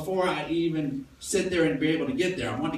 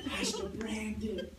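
much softer pleading call; soft child plea; 10 dB quieter than usual. Retold or imitated. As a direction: plead softly like a small girl. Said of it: "approach you on a religious term".

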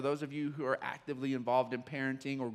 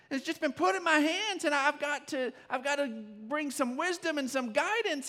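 higher, rising new-parent panic; overwhelmed panic; 10 semitones higher than usual. Retold or imitated. Young dad panicking over his newborn son. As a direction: blurt the worry out. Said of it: "and then you're like".

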